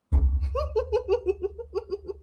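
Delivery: high, rapid giggling fit; real helpless laughter. Real, not acted. Reader cracking up mid-sentence, helpless high laughter.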